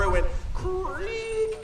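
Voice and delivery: put-on slow, high creak like an old door; drawn-out squeaky door creak; creaky door sound. Shown as a demonstration. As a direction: stretch out a creaking hinge. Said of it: "Can you go creak like a door?".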